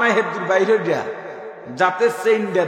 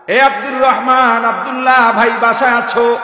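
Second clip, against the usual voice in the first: calling out at a door; 6 semitones higher, 8 dB louder than usual. Acted. A visitor calling loudly for Abdullah at his house. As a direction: hail the neighbour through the door.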